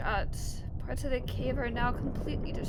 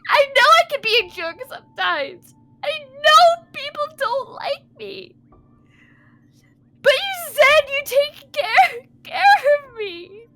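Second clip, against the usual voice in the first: loud, high, anguished wail; crying; 11 semitones higher, 12 dB louder than usual. Acted. Remy feeling betrayed by crewmate, crying loudly. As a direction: plead and accuse through sobs. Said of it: "Don't cry".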